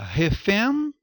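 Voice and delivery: "refém" com ẽ puro, sem ditongo; pronúncia errada. Shown as a demonstration. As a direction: suba em "refém" e pare na vogal nasal. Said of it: "ou você fala refém?". ("refém" sem o i nasal do fim)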